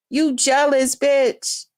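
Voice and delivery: normal talking voice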